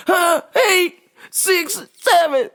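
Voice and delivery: high mock voice reading wrong numbers; imitating customer. Comedian mimics caller reading back wrong numbers, high mock voice. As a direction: rattle them off like the confused caller. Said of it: "read the wrong numbers back".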